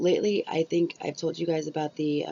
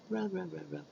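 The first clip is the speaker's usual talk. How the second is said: softer, murmured rhythmic chant; soft rub rub; 10 dB quieter than usual. Shown as a demonstration. Demonstrating her skincare routine, softly chanting. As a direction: hush it like a soothing rhythm. Miming scrubbing her face.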